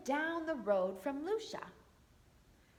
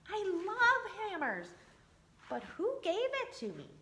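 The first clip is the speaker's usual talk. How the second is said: higher, puzzled wooden puppet voice; higher storybook voice for a surprised puppet; Punchinello's voice; 5 semitones higher than usual. Acted. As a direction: read him bright and curious. Punchinello's voice in read-aloud story.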